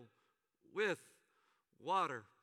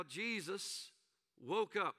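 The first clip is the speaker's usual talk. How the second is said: normal talking voice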